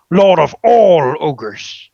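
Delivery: slow, loud proud proclamation; triumphant ogre title. A narrator's reading. Ogre proclaims his new title to the dragon, slow loud triumph. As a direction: roll out the grand title slowly and loudly. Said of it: "Grumblejack's eyes light up".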